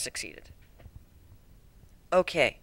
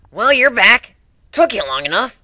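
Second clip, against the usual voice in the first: higher, louder, a touch brash; lightly voiced sporty pony, impatient; impatient Dash; 7 semitones higher, 8 dB louder than usual. Dash greeting the returning narrator, a touch impatient. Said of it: "Dash tapped her hoof impatiently".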